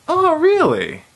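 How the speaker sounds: gullible surprise. Retold or imitated. Gullible classmate believes the lie.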